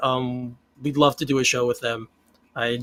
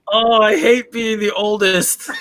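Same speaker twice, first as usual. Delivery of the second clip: mock whine; 6 semitones higher, 5 dB louder, slower than usual. Mock whining about being oldest.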